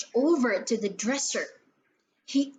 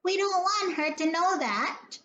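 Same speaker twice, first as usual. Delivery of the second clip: lightly voiced troll; 7 semitones higher than usual. A narrator's reading. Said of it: "said a third medium-sized voice".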